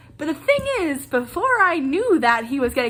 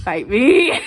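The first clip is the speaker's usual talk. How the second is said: playful defiance; 8 dB louder than usual. Retold or imitated. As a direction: throw down a cheeky dare. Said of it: "and I'm like".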